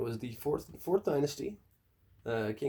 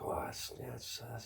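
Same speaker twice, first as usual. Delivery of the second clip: awkward whisper; 9 dB quieter, slower than usual. Retold or imitated. Courtiers' hushed reply.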